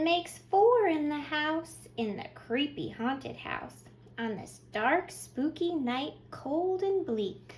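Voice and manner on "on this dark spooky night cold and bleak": usual speaking voice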